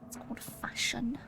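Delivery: a breathy unvoiced whisper of it's called fashion. Whispering the joke to herself again. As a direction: murmur it like a secret aside. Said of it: "whispered repeat".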